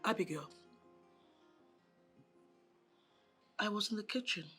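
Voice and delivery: soft, cold telling-off; cold reproach. Acted. Stepmother to Abigail, soft cold reproach. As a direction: list her failings quietly.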